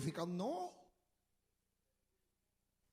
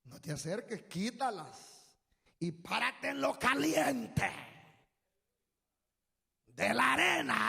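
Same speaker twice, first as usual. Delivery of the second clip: mock God voice. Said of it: "Y ahora le dice".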